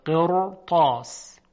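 deliberate قرطاس with a thick, held ra; heavy ra. Shown as a demonstration. Teacher shows how the word is said, heavy ra. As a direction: say قرطاس slowly, ra full to match the heavy ta.

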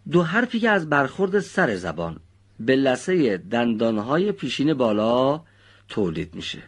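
normal talking voice

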